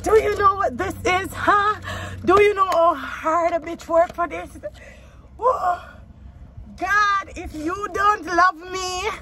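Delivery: high excited praise; ecstatic. Real, not acted. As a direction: celebrate with high joy. Ecstatic celebration.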